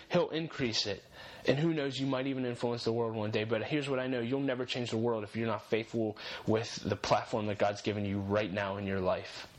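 normal talking voice

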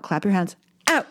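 ordinary delivery

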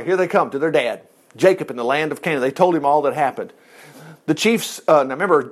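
normal talking voice

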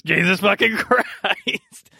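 slow curse broken by a laugh. Acted. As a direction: laugh through the curse, drawn out.

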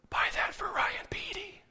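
breathy whisper, God speaking in the mind; God's whisper. Retold or imitated. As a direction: whisper it like an inner divine voice. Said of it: "I thought I heard God say in my mind".

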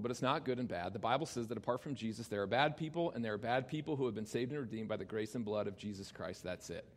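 normal talking voice

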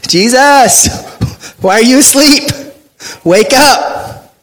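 acting out a frantic prayer to Jesus in the storm, pleading high; desperate plea. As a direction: cry out to a sleeping Jesus.

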